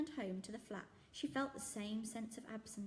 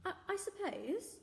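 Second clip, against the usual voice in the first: put-on hesitant girl; 11 semitones higher, slower than usual. Zoe unsure.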